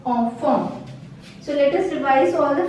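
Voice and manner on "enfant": enfant with nasal an, ahn-fahn, no T; French nasal sound. Shown as a demonstration. Teacher showing the class the nasal an in enfant. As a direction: let the an of enfant ring through the nose. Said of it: "This sound".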